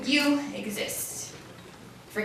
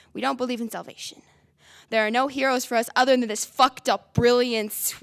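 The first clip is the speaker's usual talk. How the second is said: usual speaking voice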